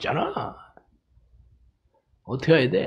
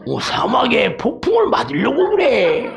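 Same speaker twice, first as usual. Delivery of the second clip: higher, animated mum explaining; hammed-up mother answering her little one; mother camel; 6 semitones higher than usual. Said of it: "낙타 새끼가 엄마한테".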